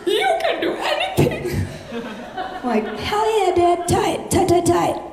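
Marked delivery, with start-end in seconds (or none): 0.0-1.7 mock choked-up, very high voice; gasp, then squeak it out overcome; imitating emotional dad. Dad watching Hidden Figures with daughter, hammed-up emotional. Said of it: "my dad's getting emotional". 3.0-5.0 high-pitched mock encouragement; acted supportive cheer. Daughter to emotional dad, high mock cheering.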